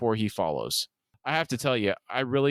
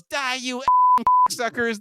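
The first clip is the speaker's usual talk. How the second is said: high-pitched mock yell; yelling attack; 13 semitones higher, 7 dB louder, slower than usual. A narrator's reading. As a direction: yell it high, without full volume.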